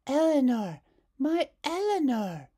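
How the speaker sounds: high, loud mourning over the doll; a girl grieving her ruined doll; grieving Hazel. Acted. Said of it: "said Hazel".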